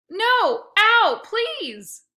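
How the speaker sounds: high, loud yelps of pain and panic; bumbling cries. A narrator's reading. Boy yelping and pleading while dragged along the grass, high and panicked. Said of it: "I bumbled".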